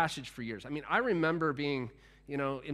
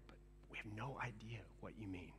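unvoiced secret whisper, much softer; 8 semitones lower, 20 dB quieter than usual. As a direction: whisper it behind the rabbi's back. Disciples' confused aside to each other.

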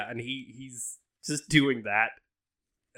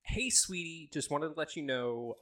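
sweet mom voice; 5 semitones higher than usual. Acted. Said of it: "she goes".

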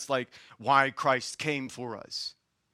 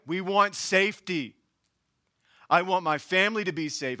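ordinary delivery

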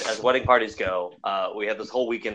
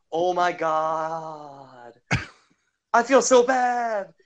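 high, slow, drawn-out apology; guilty whine; 5 semitones higher, 5 dB louder, much slower than usual. Retold or imitated. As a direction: whine it out, sorry and sheepish. Buddy apologizes, retold.

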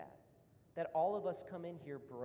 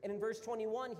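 usual speaking voice

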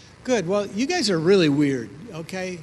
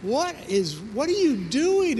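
high, incredulous question; what on earth, said high; baffled; 7 semitones higher than usual. Retold or imitated. Husband asking panicked wife, high and baffled. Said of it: "It's like".

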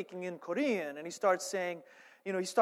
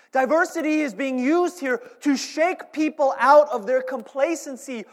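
loud, higher-pitched, emphatic preacher; impassioned preaching; 6 semitones higher, 11 dB louder than usual. Real, not acted. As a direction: drive each point home at full force. Preaches at full force.

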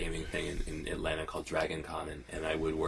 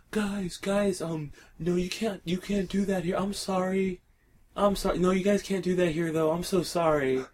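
apologetic lobby guy; 13 semitones higher, 7 dB louder than usual. Retold or imitated. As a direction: imitate the nervous lobby guard begging them to stop. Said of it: "and he's like".

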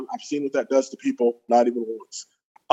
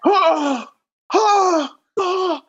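pained groaning; much slower than usual. Retold or imitated. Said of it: "and I'm like".

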